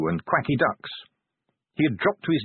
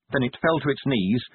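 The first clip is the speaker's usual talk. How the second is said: plain reading voice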